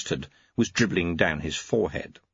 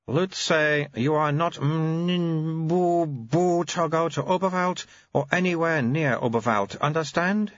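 put-on very high, babyish old abbot voice; an ancient mind in a baby's mouth; high piping infant abbot; baby-bodied old abbot; 8 semitones higher, slower than usual. Abbot babbling order.

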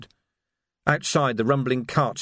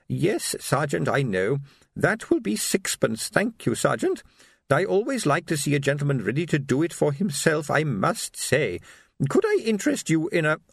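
put-on querulous old lady; 4 semitones higher than usual. Old shopkeeper selling to the sergeant, querulous and polite. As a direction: fuss sweetly in a high old voice.

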